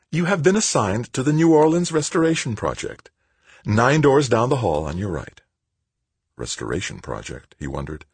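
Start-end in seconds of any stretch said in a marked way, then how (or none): 0.1-5.4 high-pitched, put-on sweetness; sweetly. A narrator's reading. Woman giving Tavalera his assignment, sweetly. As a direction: sugar it in a much higher voice. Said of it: "said sweetly".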